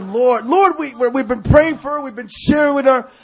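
high, loud pleading objection; acted-out whiny protest. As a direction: argue back in exasperation. Arguing back to the Lord.

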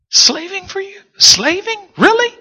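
high, squeaky disbelief; mock the complaint in a high incredulous voice; incredulous mockery. Real, not acted. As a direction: pitch it way up like you can't believe it. Mocking the son's complaint.

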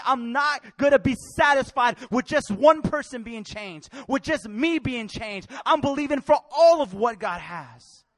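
loud, rhythmic declarations building in force; forceful, rolling sermon cadence; impassioned preaching. Real, not acted. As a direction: drive each phrase home to the congregation.

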